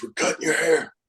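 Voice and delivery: imitating the boss; boss impression. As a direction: announce it offhand in the boss's voice. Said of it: "He goes".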